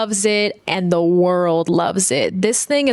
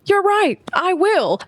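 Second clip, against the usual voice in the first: pumped-up heroine vowing to act; fired up; 11 semitones higher than usual. Retold or imitated. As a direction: sound newly determined. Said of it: "She's like".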